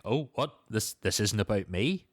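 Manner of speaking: normal talking voice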